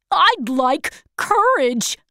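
Lion. A narrator's reading. Lion asks for courage.

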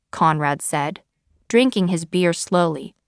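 ordinary delivery, nothing marked

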